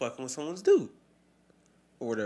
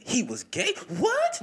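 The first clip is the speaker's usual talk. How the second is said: mock disbelief; 13 semitones higher, 6 dB louder, slower than usual. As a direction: pitch leaping high on What. Voicing shocked public reaction.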